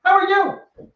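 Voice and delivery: perky morning hello from the office optimist; bubbly office coworker. Retold or imitated. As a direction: sing it out like the too-cheerful one at the office. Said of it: "the bubbly one in the office".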